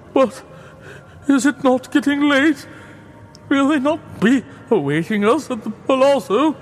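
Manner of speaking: high, quavering voice of a broken man; Fortunato's sad voice. A narrator's reading. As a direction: laugh it off, pretending it's a joke. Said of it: "It was succeeded by a sad voice".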